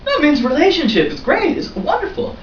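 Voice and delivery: mock naive gushing. Friend mocking his naive meaning.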